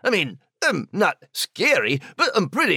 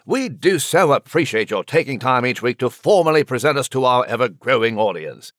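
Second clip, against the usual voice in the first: British gentleman voice; 7 semitones lower than usual. Acted. [name]'s posh character voice.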